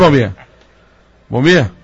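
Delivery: loud call of a name. Real, not acted. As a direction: call the name out across the room. Calling someone on the phone.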